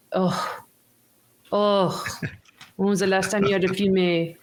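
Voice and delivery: put-on German soldier, fast, in accent; lightly accented German soldier. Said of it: "My, my German accent's gonna be awful".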